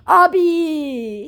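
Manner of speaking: high, put-on Nigerian lilt; Nigerian pidgin mock. Quoting the scammer's pidgin Abi, high-pitched.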